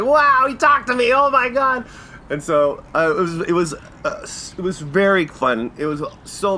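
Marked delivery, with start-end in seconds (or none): 0.0-1.8 high, loud giddy squeal; starstruck glee. Retold or imitated. Fan thrilled a star spoke to him, high and giddy. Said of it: "I was like".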